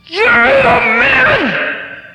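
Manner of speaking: very high, loud, drawn-out maniac scream; all-out scream. Retold or imitated. Counselor out of control in the husband's face, maniacal screaming. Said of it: "screams as loud as a person could scream".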